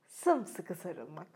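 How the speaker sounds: sımsıkı sarılmak yumuşak ve yavaş; tender. A narrator's reading. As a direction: linger lovingly on sımsıkı sarılmak. Contrasted repetition of hug word.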